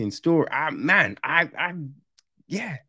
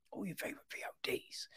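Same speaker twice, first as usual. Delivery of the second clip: fast, much softer whisper; whispered aside; 5 semitones higher, 21 dB quieter, much faster than usual. Shown as a demonstration. Reviewer repeating his plug to viewers, fast whisper. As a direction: hush it like a secret tip.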